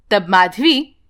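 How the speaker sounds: ordinary delivery, nothing marked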